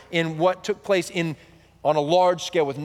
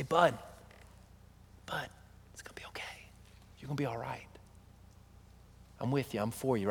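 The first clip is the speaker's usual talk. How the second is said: whispered, much softer and lower; 5 semitones lower, 15 dB quieter than usual. Shown as a demonstration. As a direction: crouch down and comfort in a hush. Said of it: "I get down and say".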